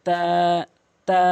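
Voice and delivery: তা with the vowel held one count; one-alif stretch. Shown as a demonstration. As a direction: draw out তা, tongue at upper tooth roots. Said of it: "এক আলিফ টেনে পড়বেন".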